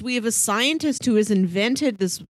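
normal talking voice

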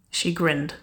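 ordinary delivery, nothing marked

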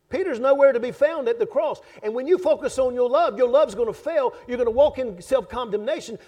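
rising preaching. Acted. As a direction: build the point to a climax. Preaching at full force.

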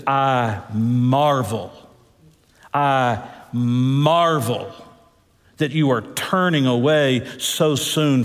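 very slow, drawn-out marvel; slow awed reading. Acted. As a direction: let each word hang in wonder. Quoting Paul slowly in awe.

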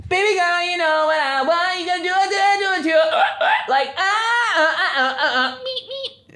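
very high-pitched imitation of a blaring phone video; mimicked high TikTok soundbite; blaring TikTok audio. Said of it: "I open TikTok and it's like".

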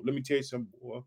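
ordinary delivery

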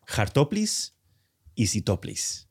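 hartoplis said as har-TO-plis, rising; Spanish-sounding made-up words. Shown as a demonstration. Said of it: "if I say it slowly".

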